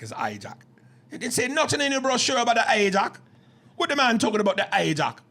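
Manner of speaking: high, loud mock Jamaican; Jamaican accent. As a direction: argue in a put-on Jamaican accent. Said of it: "if it had been Jamaicans".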